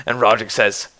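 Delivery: ordinary talk, nothing marked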